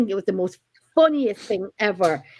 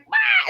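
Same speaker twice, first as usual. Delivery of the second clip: high squeal of fright; imitating a friend's squeal; mock shriek. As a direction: yelp like you saw a spider. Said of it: "Louise is like".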